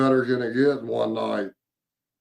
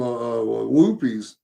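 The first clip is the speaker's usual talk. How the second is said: ordinary talk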